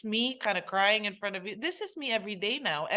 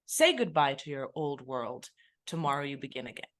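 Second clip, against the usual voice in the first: low, matter-of-fact order; 7 semitones lower than usual. Retold or imitated. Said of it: "It was just".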